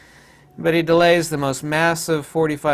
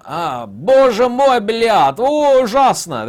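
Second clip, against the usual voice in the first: mock Russian exclamation; 7 semitones higher, 7 dB louder, slower than usual. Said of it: "I had to switch from German to Russian".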